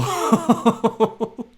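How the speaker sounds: big loud burst of laughter. Real, not acted. Laughs at own panic.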